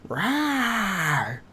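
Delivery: a playful, high little rawr for chat; cute rawr. Shown as a demonstration. Streamer doing her trigger word for chat, a cute high rawr. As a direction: growl like a cuddly cartoon monster. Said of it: "roar is a trigger word".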